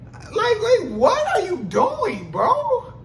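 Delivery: high, loud, slow, pleading disbelief; outraged at the grabbing stranger; exasperated. Retold or imitated. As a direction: stretch it out like you can't believe it. Exasperated retort to the man.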